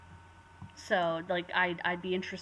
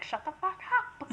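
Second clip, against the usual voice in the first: mock shout; 12 semitones higher than usual. Game master imagining yelling at noisy players, mock shout. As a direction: pretend to yell at a rowdy group. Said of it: "I wasn't gonna shout at them".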